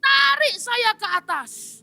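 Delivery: high-pitched commanding voice; bossy demand. Acted. Man orders the angel to lift him, high bossy demand. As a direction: order him to pull you up. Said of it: "mulai berkata".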